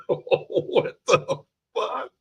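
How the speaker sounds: high, slow, drawn-out what the fuck; stunned disbelief. Retold or imitated. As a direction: stretch it out in shocked disbelief, way up high. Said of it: "I'm just like".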